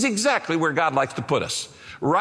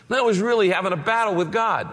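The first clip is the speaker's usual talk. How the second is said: ordinary talk, nothing marked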